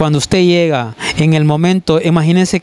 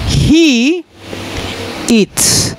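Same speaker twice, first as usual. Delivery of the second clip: he high and eats with hissed s; stress on the ending; 8 semitones higher than usual. Shown as a demonstration. Teacher showing students the ending: eats with hissed s. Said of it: "pequeña ayuda con la palabra".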